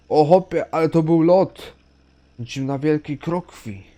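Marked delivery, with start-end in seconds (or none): 0.1-1.7 higher, louder thrilled cry; excited shout. Real, not acted. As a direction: shout at the big jump. Bus jump, loud exclamation.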